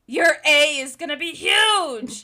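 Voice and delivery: loud, high, drawn-out mock proclamation; proclaiming. Spencer unveiling his surprise sculpture to his sister, proclaiming. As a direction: proclaim it like a grand unveiling, slowly. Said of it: "and he proclaims".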